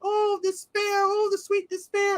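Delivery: very high, slow, dreamy squeak; high-pitched kitty voice; little kitty voice. Retold or imitated. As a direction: savor despair like a tiny kitty. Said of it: "it's the voice, 'cause it's like a little kitty voice".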